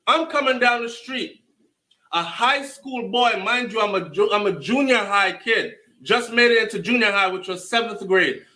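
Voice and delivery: ordinary talk, nothing marked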